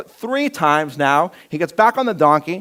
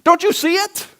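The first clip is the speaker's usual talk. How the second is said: exasperated donkey; 3 dB louder than usual. Retold or imitated. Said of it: "the donkey turns and like".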